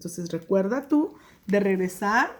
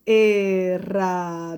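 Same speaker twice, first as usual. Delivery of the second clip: "Herradura" said slowly and loudly; teacher says "Herradura" slowly to count the syllables; slow model word; 3 semitones lower, 4 dB louder, slower than usual. Shown as a demonstration. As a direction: stretch the word out. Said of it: "cuántas sílabas".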